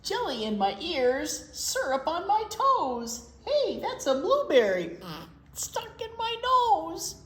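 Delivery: bouncy sing-song storybook reading; reading to children. Acted. Librarian reading pancake rhymes to kids, sing-song. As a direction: read the rhyme aloud to little kids. Reads rhyming picture book aloud.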